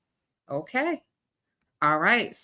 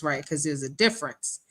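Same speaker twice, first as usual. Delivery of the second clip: normal talking voice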